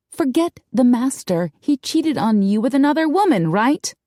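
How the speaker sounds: emphatic, challenging retort; insistent. A narrator's reading. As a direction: push back impatiently. Darcy argues back.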